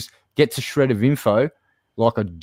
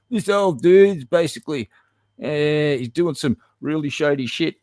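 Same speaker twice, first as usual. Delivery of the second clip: mocking Keem; 6 semitones higher than usual. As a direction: sneer it out as the accuser. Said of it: "and says".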